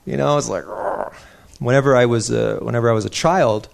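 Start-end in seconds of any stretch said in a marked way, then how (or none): 0.7-1.1 a short, soft, breathy grunt of frustration; fierce grunt. Shown as a demonstration. Preacher showing the congregation how wrath feels, with a soft grunt. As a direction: push out a quick, pent-up grunt. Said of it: "this fierceness".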